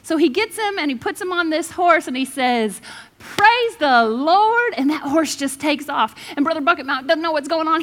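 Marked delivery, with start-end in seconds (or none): 3.2-4.7 slow, loud, higher "Praise the Lord"; drawn-out cue. Retold or imitated. Pastor giving the horse its start word, slow and loud. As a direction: stretch it out like a command.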